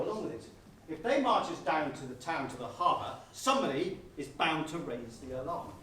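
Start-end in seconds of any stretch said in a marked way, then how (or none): none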